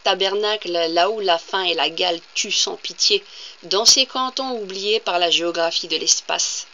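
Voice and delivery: declaimed poem, firm and measured phrasing; poetry reading with deliberate pauses between lines; poem recitation. Acted. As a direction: read it out as verse, projecting each line. Reads a poem aloud.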